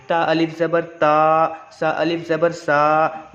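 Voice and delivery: ता and सा stretched long, slow; drawn-out vowels. Shown as a demonstration. Teacher demonstrates long vowels, slow stretched reading. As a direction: pull each vowel out gently. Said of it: "थोड़ा खींच के पढ़ेंगे".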